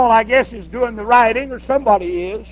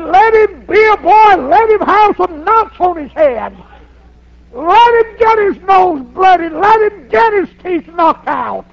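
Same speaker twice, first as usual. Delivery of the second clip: raised, higher insisting; insistent grandpa; 5 semitones higher, 4 dB louder than usual. Retold or imitated. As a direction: hammer the point home to the worried wife.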